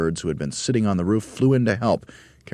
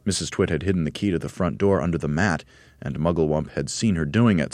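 ordinary delivery, nothing marked

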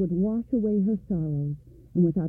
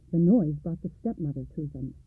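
ordinary delivery, nothing marked

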